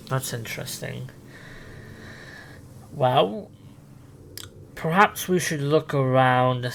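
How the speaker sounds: normal talking voice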